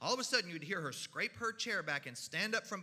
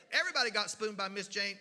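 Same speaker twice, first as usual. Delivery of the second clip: ordinary delivery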